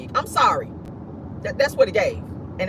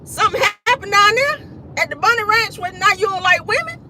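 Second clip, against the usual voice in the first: very high-pitched disbelieving question; hammed-up bafflement, pitched way up; mock incredulity; 11 semitones higher than usual. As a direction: squeal the question in comic disbelief.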